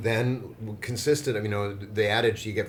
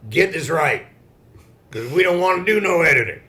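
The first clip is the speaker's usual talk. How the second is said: mock bossy editor; 8 semitones higher, 10 dB louder than usual. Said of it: "It's like".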